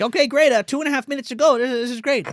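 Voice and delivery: high, fast, nervous babble; mock panic covered with eagerness; forced cheer. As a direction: imitate someone talking himself through it. Corky feigns confidence while panicking.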